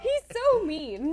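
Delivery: hammed-up fake sob, then slow whine; mock crying. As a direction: play-act being hurt. Mock complaint about being bullied.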